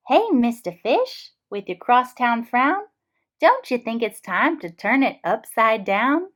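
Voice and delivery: a perky friend coaxing a smile; cheery clam. Acted. The clam urging her glum pal to cheer up.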